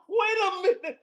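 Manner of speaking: high, stunned wait a minute; stopped one step short, high disbelief; retold disbelief. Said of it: "I was like".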